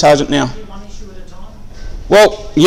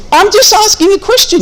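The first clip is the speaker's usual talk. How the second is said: repeats the line much higher, pleading innocence; high exasperated insistence. Retold or imitated. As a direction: pitch it way up as if stating the obvious. Repeats his line in raised voice.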